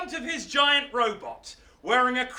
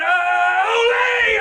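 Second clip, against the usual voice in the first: long, high, loud olé; matador's cry; 10 semitones higher, 11 dB louder than usual. Acted. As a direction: belt it out like a bullfighter. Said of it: "and he went".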